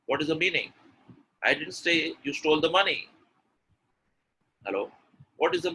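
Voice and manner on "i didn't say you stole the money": "money" picked out at the end; raised, emphatic "money"; stress on money. Shown as a demonstration. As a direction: land firmly on "money". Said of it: "And the last one".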